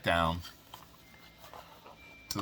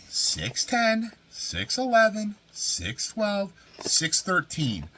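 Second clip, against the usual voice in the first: slow, high, louder countdown; high dramatic count; 13 semitones higher, 5 dB louder, much slower than usual. Retold or imitated. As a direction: count each room like a big reveal. Brother showing friend the count.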